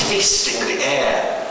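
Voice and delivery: usual speaking voice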